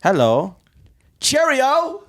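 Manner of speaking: mock British "Cheerio", squeaked very high; terrible fake British accent; bad British accent. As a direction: ham up a posh British greeting. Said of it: "That's terrible".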